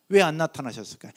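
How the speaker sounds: normal talking voice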